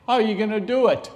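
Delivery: high, loud, fast mock shout; shouting challenge. Wife shouting at her husband about the marathon. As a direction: fire the question back at him loudly.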